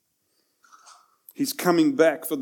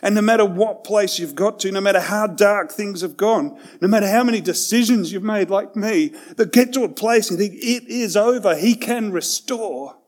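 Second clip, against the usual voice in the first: louder, higher preaching climax; full-force preaching; 4 semitones higher, 4 dB louder than usual. Acted. Climactic appeal to listeners.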